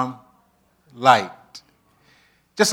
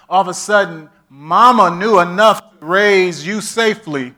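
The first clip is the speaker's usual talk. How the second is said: normal talking voice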